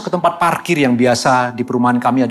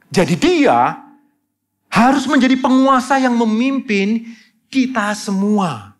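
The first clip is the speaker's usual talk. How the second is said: full-force preaching; 11 semitones higher than usual. Acted. Pastor stressing the Spirit's leading to fellow ministers, in a soaring preaching voice. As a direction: drive the doctrine home to the congregation.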